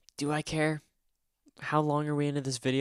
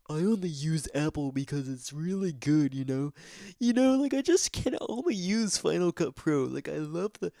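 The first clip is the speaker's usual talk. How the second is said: uppity Apple fan; 4 semitones higher, 4 dB louder than usual. Retold or imitated. Mocking uppity Apple people, smug snob voice. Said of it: "I'm not trying to do a certain somebody".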